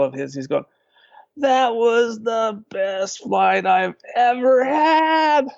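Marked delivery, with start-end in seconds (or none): 1.4-5.5 much higher, slow, overjoyed exclaim; thrilled. Retold or imitated. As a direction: gush with giddy delight despite the crash. Crashed pilot thrilled after wreck.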